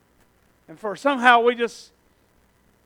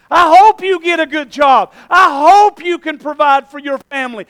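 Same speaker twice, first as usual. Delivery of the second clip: urgent call; 3 semitones higher, 6 dB louder than usual. Real, not acted. Preacher pleads loudly with kids at the reunion. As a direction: press it on them hard.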